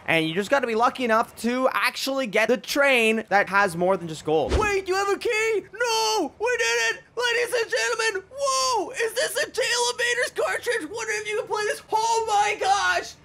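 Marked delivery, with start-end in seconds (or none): 4.6-13.1 much higher, near-tearful yells of joy; real disbelief after nine hours of grinding; overjoyed streamer finally finds the key; ecstatic shouting. Real, not acted. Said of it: "I'm gonna cry".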